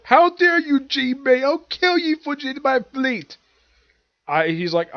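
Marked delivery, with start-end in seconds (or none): 0.0-3.4 much higher, loud furious shriek; furious Big Mom. Retold or imitated. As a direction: rage at Jinbei as the enraged empress. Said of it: "she's like".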